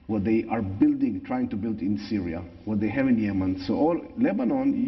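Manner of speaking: English with a natural Israeli accent. Real, not acted. Same speaker continues.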